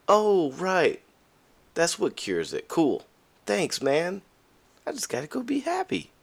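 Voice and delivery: high, mock-cheerful sarcasm; high, sing-song mock thanks; sarcastic. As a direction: play dumb gratitude, dripping irony. Sarcastic reply to "be happy.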